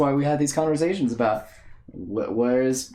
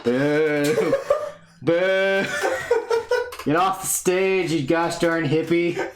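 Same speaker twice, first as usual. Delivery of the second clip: higher, slow laughing heckler booing; 3 semitones higher, much slower than usual. Pretend. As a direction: jeer someone off the stage, laughing. Mock heckling a performer off stage.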